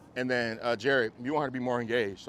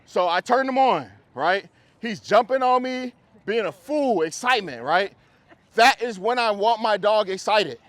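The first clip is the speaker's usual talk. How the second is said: higher and louder, slow and pumped; excited; 10 semitones higher, 11 dB louder, slower than usual. Real, not acted. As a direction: keep the energy high for the dog. Trainer hyping dog while explaining.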